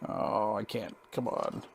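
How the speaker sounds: soft annoyed mumble to self; frustrated mutter. Real, not acted. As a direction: grumble to yourself as it won't work. Struggling with the meter, mutters.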